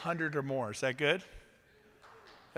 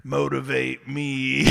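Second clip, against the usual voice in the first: bored challenge; 4 semitones lower, 7 dB louder, slower than usual. Pretend. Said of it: "You're not sitting there".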